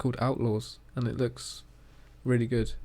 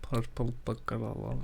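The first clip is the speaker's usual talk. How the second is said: softer mock Scottish "Purple burglar alarm."; Scottish accent; 4 dB quieter than usual. Shown as a demonstration. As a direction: try the Scots lilt again, quietly. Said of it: "It doesn't sound Scottish".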